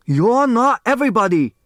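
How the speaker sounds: loud high angry shout; a furious high yell at his son; angry retort. Acted. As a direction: snap back at him furiously. Father snapping at son.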